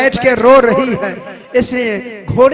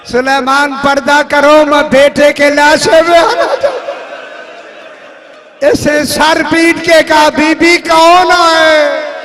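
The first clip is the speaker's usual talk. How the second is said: preaching at full force; 4 semitones higher, 7 dB louder than usual. Acted. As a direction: cry the lament out at the top of the voice. Climax of majlis lament.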